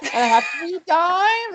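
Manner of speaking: high, slow, drawn-out coin counting; slow fussy customer. Retold or imitated. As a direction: imitate her listing each coin. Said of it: "She's like".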